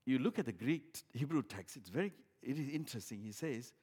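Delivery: usual speaking voice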